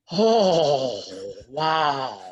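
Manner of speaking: a hint of the actor's delighted wonder; mild imitation of a delighted famous guest; impressed old actor's "oh wow". Christopher Lloyd voiced in story.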